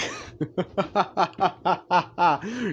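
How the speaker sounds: high staccato ha-ha-ha; mocking villain laugh. All For One laughs at Deku's armor, hammed-up villain laugh. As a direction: cackle in a steady run, scornfully. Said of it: "just laughing like".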